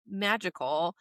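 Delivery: ordinary talk, nothing marked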